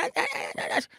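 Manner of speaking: very high, thin mock disgust; mock jeering chorus. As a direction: squeal like mocking kids. Chain reaction of kids jeering. (hånende hyl, veldig høyt)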